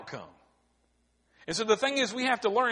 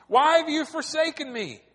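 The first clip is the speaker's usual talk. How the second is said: loud raised cry, pitch higher; shouted plea; 7 semitones higher, 5 dB louder than usual. Retold or imitated. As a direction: call out to God in rage, higher and louder. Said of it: "shouted to God".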